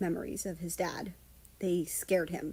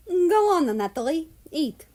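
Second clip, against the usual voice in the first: coaxing; 7 semitones higher, 6 dB louder than usual. Acted. Coaxing the cat to eat.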